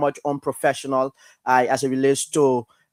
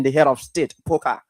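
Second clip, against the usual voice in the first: normal talking voice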